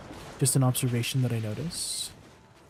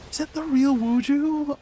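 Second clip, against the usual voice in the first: much higher, squealing with excitement; high-pitched fangirl squeal; excited squeal; 12 semitones higher than usual. Real, not acted. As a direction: shriek it like a fan who just spotted her idol. Spots a surprise guest in chat.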